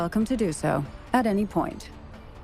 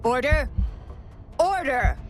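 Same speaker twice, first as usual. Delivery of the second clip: higher, firm, ringing call; calling for order; 5 semitones higher than usual. Acted. Judge silencing the hearing, commanding call. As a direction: call out sharply over the murmuring.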